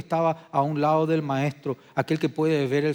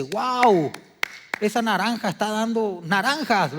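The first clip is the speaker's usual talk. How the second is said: bright mock wonder, pitched up and louder; amazed exclamation; 7 semitones higher, 6 dB louder than usual. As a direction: gush over the fruit like a delighted bystander. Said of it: "nadie no le dice".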